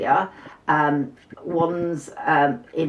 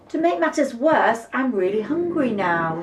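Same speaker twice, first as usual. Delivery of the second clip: high, whiny complaint; a small mouse moaning with hunger; hungry little mouse; 7 semitones higher than usual. Acted.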